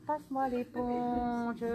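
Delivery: slow, drawn-out voice from beyond; dreamy medium relaying a voice, slowly; slow relayed voice. Retold or imitated. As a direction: stretch it out like a message from far away.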